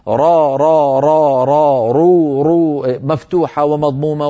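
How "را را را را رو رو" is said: را and رو, the ra rolled firmly with each vowel; ra drill. Shown as a demonstration. Teacher drills the ra with each vowel for a student. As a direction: loudly drill را را رو, trilling the ra each time. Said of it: "كرر حرف الراء مرات وكرات".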